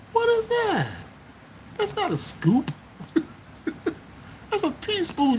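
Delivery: high, incredulous squeal at the tiny scoop; mock outrage. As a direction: act shocked at how small it is. Mock complaint at ice cream shop.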